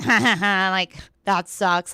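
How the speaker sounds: slow, imitated jeering "ha ha ha"; mocking laugh, retold. Traffic cop laughing at a woman running down the road, mock jeering. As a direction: imitate someone laughing at you, slowly. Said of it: "they're laughing at me".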